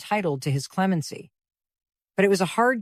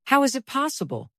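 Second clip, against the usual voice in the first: startled cry; 6 semitones higher than usual. A narrator's reading. Emma, caught off guard by Knightley's news, cries out higher. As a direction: pitch jumps higher on a startled question. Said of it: "cried Emma".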